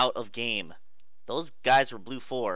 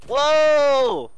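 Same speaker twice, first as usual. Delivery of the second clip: sudden high yelp, much louder; a jolt of real surprise; loud high whoa; startled shout; 12 dB louder than usual. Real, not acted.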